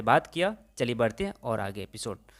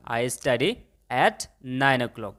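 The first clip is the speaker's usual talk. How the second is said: very slow, each word separate; slow model sentence; much slower than usual. Shown as a demonstration. Teacher models the answer for students, very slowly. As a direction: lay it out slowly for the class. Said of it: "तो आपने क्या बोला".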